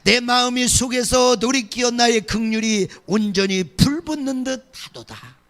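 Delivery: loud, declaiming Bible reading; fervent scripture reading. Acted. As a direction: proclaim the verse to the congregation. Reads Hosea aloud.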